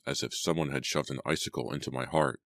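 ordinary delivery, nothing marked

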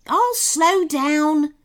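high, slow sloth voice; sloth calling back. A narrator's reading. Sloth driver agreeing to Kenny, calling back. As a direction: draw the words out as a call. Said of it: "called back the sloth".

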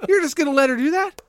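mock incredulous. Mocking a movie moment.

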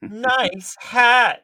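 high, loud sarcastic jab; mocking quip. Joining the joke with a loud insult, mock shout. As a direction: call out a taunt about the hat.

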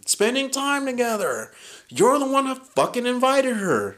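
high, loud retold rant, easing toward the end; pissed-off outburst. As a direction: re-enact blowing up at a girlfriend. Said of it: "Then I got fucking pissed".

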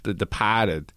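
lightly put-on Boston accent. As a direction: drop the R, Boston style. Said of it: "and then he's Boston in".

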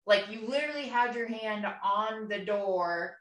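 ordinary talk

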